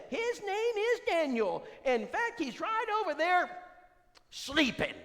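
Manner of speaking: very high, loud falsetto woman; high falsetto imitation of Brenda; Brenda's voice. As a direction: squeal it like Brenda at the door. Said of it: "Brenda answers".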